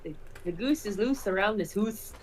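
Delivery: a tentative Scottish accent try. Shown as a demonstration. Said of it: "Try it".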